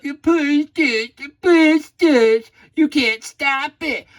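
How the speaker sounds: high, slow, far mock whine; mock a whining rival fan, high and drawn out; whiny fan. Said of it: "wanna whine about".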